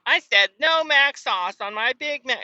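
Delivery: high, complaining customer voice; whiny customer. Retold or imitated. Picky customer complaining about the Big Mac sauce. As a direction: whine about the order.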